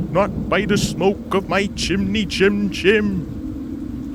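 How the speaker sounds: high, slow, sing-song defiance; mock storybook refusal. Toby refusing the ghost, hammed-up chant. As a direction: chant it theatrically, savouring each word. Toby parodies the three little pigs.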